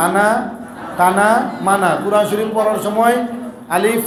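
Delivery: normal talking voice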